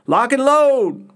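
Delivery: loud, high, drawn-out holler; dugout yell. A narrator's reading. Guys in the dugout to Colt forty-five, yelling. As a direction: yell it out long like a rallying cry. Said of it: "would yell shit like".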